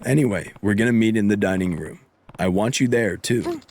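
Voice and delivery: ordinary talk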